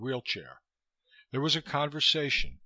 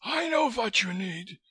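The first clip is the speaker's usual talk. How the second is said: put-on high, damp wheezy Russian accent; high and wet-voiced, dismissive; 11 semitones higher, 4 dB louder than usual. A narrator's reading. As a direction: cut in damply, pitched well up. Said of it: "he said damply".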